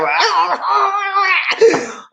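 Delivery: long high wail; hammed-up howl of losing it; mock wailing scream. Host unwilling to do the show, mock wailing scream. Pretends to crack before the show.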